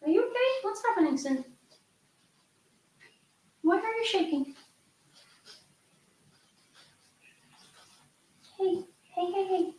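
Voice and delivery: high, soft coaxing voice to a frightened dog; worried pet talk. Real, not acted. Owner asks her shaking dog what's wrong, high anxious coo.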